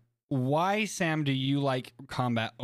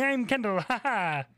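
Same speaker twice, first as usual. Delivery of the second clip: high, giggly self-introduction as a friend; Kendall impression; 8 semitones higher than usual. Host showing off a practiced impression to its target, high and giggly. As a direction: put on a friend's high voice with a little laugh. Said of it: "do an impression of you".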